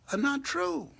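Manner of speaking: soaring high on true; high preaching cry. Real, not acted. Preaching climax.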